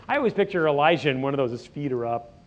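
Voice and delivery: ordinary talk, nothing marked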